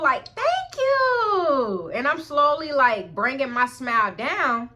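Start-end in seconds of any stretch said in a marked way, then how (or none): 0.3-1.9 very high, long singsong thanks; joyful thanks. Retold or imitated. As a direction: act out your joyful self, stretching the words. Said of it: "I'm in the joyful".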